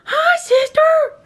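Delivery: high sing-song pet voice; cooing at the cat; baby talk to pet. Acted. Greeting the cat in baby talk.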